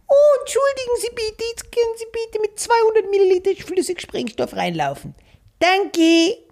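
high mocking official voice; mock security officer. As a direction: sneer like a fussy airport guard.

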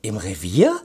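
curious question with a sharp final rise. A narrator's reading. Boy asks about the aunt's workplace, curious. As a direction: start low and swing up, curious. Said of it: "fragte Bob neugierig".

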